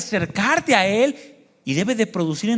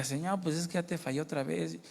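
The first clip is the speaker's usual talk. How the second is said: hushed ashamed prayer; 3 semitones lower, 12 dB quieter than usual. Retold or imitated. Retold shamed prayer to God.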